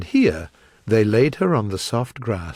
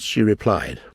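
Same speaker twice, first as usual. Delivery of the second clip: ordinary delivery, nothing marked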